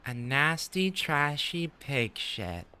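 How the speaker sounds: high, slow, nasty drawn-out insult; sneering thug. Acted. Game thug voiced sneering at the Index, slow high contempt. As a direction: spit the words out slowly with contempt.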